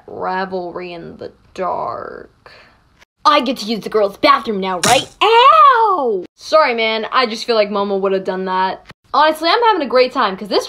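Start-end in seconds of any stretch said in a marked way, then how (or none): 0.1-2.5 low, slow, softer brooding voice; Tokoyami. Pretend. Mina retries a gloomy classmate's catchphrase, low and brooding. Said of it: "It's not giving Tokoyami". 5.2-6.2 loud, high "ow"; sudden high cry of pain; pained yelp. Acted. Classmate hurt mid-chat, high pained yelp.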